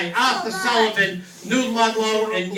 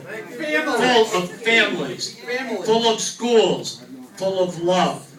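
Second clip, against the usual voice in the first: ordinary talk, nothing marked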